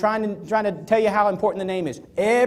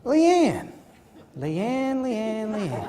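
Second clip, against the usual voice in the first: exaggerated repetition; 5 dB quieter, much slower than usual. Shown as a demonstration. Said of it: "repeat the name naturally".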